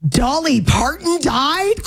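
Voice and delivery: high, slow, shocked reading; all-caps alarm. Retold or imitated. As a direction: read it like a stunned text in caps. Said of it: "All capital letters".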